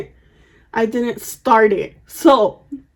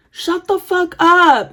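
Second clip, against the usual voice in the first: slow, higher-pitched retort from afar; drawn-out retold shout; mock angry yell; 6 semitones higher, slower than usual. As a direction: imitate an irritated neighbour hollering back. Retold neighbour yelling back.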